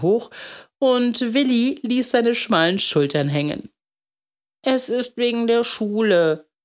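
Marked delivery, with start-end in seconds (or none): 4.6-6.5 put-on small, higher child's voice; lightly voiced sheepish confession; meek boy. A narrator's reading. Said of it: "sagte er kleinlaut".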